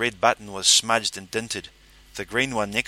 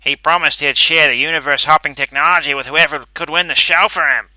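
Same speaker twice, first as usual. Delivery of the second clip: disgruntled; 6 semitones higher, 10 dB louder than usual. A narrator's reading. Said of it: "disgruntled-sounding Calverley".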